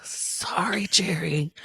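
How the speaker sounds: hushed, guilty apology; whispered apology. Real, not acted. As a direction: whisper a sheepish sorry. Mock apology to absent husband.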